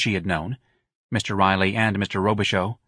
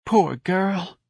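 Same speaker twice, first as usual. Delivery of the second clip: high-pitched sympathetic lament; emotional; 10 semitones higher than usual. Acted. Mrs. Riley pitying the dead woman, emotional. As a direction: pity her, voice pitched up high. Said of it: "Missus Riley said emotionally".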